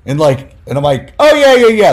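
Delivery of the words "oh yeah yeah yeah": a sudden high, loud "Oh, yeah, yeah"; brightly blurted out, caught off guard; surprised quick yes. Retold or imitated. Young writer to angry reporter, surprised.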